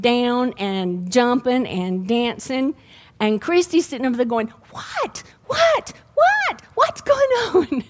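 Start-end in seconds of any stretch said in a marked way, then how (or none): 4.7-7.8 high, squeaky repeated "What?"; a bewildered friend who can't follow the call; puzzled friend. Retold or imitated. Friend hears only one side of the call, high and baffled.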